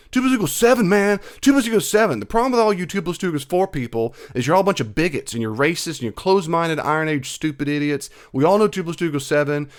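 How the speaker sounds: high, loud, indignant mock rant; mock ranting relative. Imitating the family member who rants at you, high and loud. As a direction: ham up a self-righteous zealot insisting on nonsense. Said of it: "every time he came around you and said".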